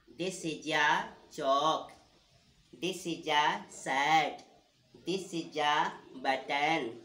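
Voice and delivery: patient teacher drilling vocabulary; slow drill. Shown as a demonstration. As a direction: model every sentence slowly for the class to repeat. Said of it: "teacher drilling example sentences".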